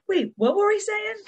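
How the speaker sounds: high, laughing mock confusion; imitated confused teacher. Teacher joking about forgetful teachers, high laughing mock confusion. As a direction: lightly send up losing your thread, pitched high. Mimics teachers losing their thread.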